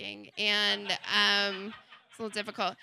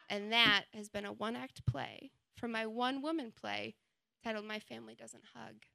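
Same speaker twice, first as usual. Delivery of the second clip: ordinary talk, nothing marked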